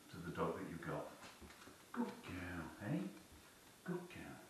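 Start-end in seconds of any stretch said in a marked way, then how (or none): 1.9-3.0 breathy whisper, soft praise for the dog. Real, not acted. Trainer praising his dog in a whisper. 3.8-4.4 high, soft praise for the dog. Real, not acted. Trainer cooing praise to his dog softly.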